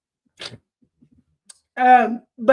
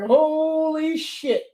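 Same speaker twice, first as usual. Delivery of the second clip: drawn-out, higher "holy"; worked-up disbelief; 6 semitones higher, much slower than usual. Retold or imitated. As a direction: stretch it out, stunned at your own panic. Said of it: "I was getting worked up".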